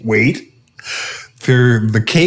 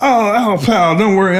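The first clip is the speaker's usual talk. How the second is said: mock principal; 8 semitones higher than usual. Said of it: "was like".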